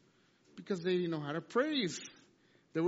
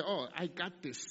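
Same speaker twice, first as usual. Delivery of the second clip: casual brush-off; 3 semitones lower, 7 dB quieter than usual. Retold or imitated. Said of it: "you say".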